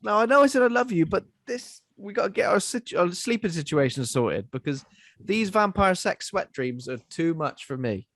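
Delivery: pleading with her to sort the sleeping; mock exasperated partner. Boyfriend pleading with his partner about sweat dreams, exasperated. Voicing the man fed up with dreams.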